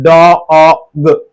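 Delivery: डॉग drawn out sound by sound. Shown as a demonstration. Teacher counting vowels for students, sounded out. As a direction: stretch डॉग into d, o, g. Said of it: "डॉग में कितने वावेल".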